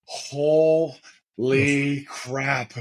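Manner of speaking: very slow, stretched-out words; awestruck. Retold or imitated. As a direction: draw out HOLY and CRAP in stunned awe. Retells awe facing huge opponents.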